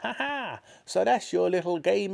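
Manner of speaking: put-on high, triumphant old woman; crying-out Grandma. A narrator's reading. Grandma catching George with the cup, high triumphant cry. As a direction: crow knowingly in a high voice. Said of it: "she cried".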